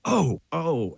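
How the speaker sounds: retold high "oh, oh" of pain; winded groan. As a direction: imitate reeling from an elbow to the chest. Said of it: "And I went".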